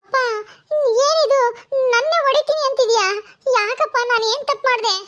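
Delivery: a grown daughter quarrelling with her father, stung; upset daughter. Acted. Daughter Netra protests to her father, hurt and indignant.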